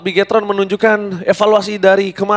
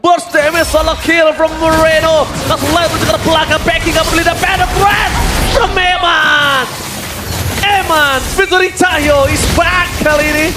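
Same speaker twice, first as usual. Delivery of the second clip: fighting game announcer; 5 semitones higher than usual. Acted. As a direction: call the fight like an arena announcer. Shouted esports play-by-play.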